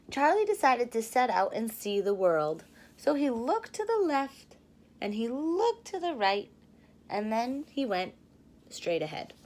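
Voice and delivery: lively storybook narration; teacher reading a picture book to her class; reading to children. A narrator's reading. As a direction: read it like storytime for little kids.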